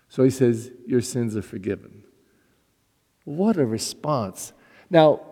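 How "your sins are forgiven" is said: tender. Retold or imitated. Jesus speaking to the paralyzed man.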